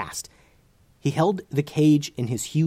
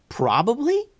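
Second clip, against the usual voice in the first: high rising alarmed question; 13 semitones higher than usual. Acted. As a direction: squeak it in disbelief. Lucas alarmed at probably.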